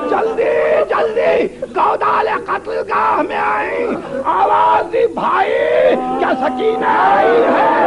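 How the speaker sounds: high-pitched, grief-stricken recitation; wailing majlis lament. A narrator's reading. Preacher tells of finding Sakina at the killing ground, wailing lament. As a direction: wail the phrases out to mourners.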